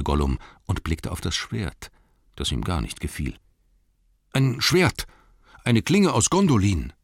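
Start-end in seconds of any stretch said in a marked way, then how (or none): 4.3-6.9 high, loud hobbit voice; proud hobbit. Acted. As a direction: announce it boldly. Bilbo voiced in story.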